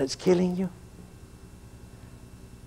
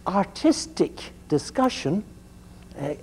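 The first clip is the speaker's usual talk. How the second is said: ordinary delivery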